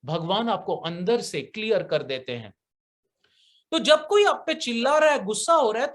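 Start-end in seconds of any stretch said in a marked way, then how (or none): none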